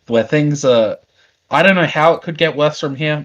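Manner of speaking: ordinary talk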